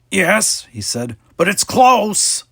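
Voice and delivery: high, slow, loud fretful creature voice; a nervous alien warning it's close; worried alien. A narrator's reading. Jankum worried about shields.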